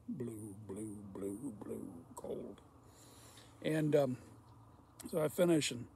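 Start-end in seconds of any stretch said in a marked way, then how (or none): 0.1-2.6 low, hushed repeats dying away; fading to a whisper. Retold or imitated. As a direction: let each word sink lower into a whisper. Trailing off describing being frozen blue.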